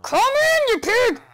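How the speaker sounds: high, loud call at the pig; fed-up yell at the pig; exasperated yell. Real, not acted. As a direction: shout it at a stubborn animal. Yelling at stubborn pig.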